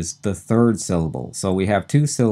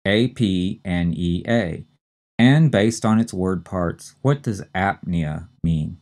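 normal talking voice

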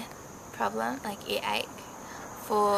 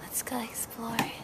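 whispering; 9 dB quieter than usual. Pretend. Whispered adventure.

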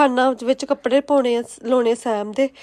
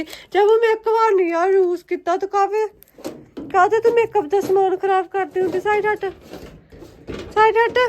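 high, loud telling-off; loud scolding; 9 semitones higher, 5 dB louder than usual. Real, not acted. Mother scolds toddler Jaivu. (ਉੱਚੀ ਝਿੜਕ)

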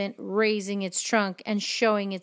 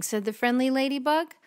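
ordinary delivery

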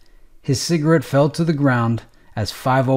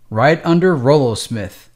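usual speaking voice

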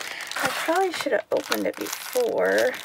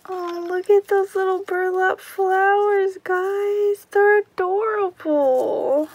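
excited; 7 semitones higher than usual. Real, not acted. Admiring burlap flowers.

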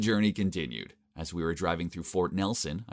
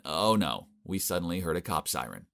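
plain reading voice

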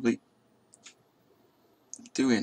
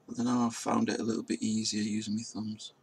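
normal talking voice

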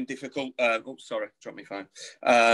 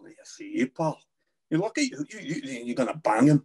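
threatening Glaswegian mumble, jumping higher midway; Glaswegian accent; 3 semitones higher than usual. Shown as a demonstration. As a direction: intimidate someone in a Glaswegian accent. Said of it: "it'd be like Glaswegian as well".

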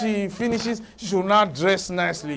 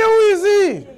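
higher, louder indignant retort; indignant outburst; 12 semitones higher, 4 dB louder than usual. Retold or imitated. Pastor retelling his outburst at the lady's boyfriend, loud and indignant. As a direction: fire back at the absent boyfriend.

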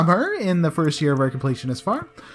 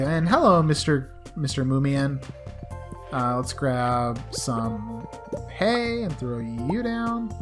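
usual speaking voice

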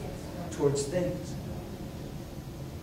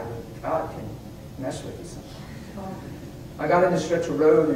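normal talking voice

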